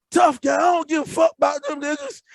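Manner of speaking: put-on high, loud drunken protest; drunk yelling. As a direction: yell it back, drunk and defiant. Drunk yelling back on phone.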